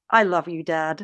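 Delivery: hushed lightly voiced child; tender child. Child telling God.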